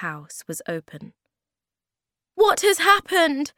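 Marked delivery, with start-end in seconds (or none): 2.3-3.5 high, loud, alarmed question; crying out. A narrator's reading. Moomin Troll amazed by the jungle indoors, loud cry. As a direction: demand an explanation urgently. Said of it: "he cried".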